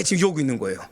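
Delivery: ordinary talk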